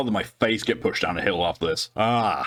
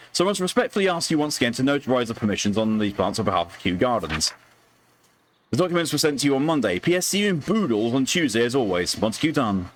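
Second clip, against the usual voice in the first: higher, formal letter reading; formal gentleman letter voice; 6 semitones higher than usual. Acted. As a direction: read it like a Victorian gentleman. Reads a letter in character.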